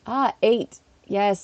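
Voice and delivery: usual speaking voice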